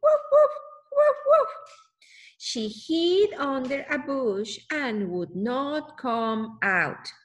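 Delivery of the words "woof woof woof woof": high, loud woofs like a small dog; barking dog. Acted. Reading the picture book to a child, loud high barking. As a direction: bark it out high and sharp. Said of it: "heard the neighbor's door barking".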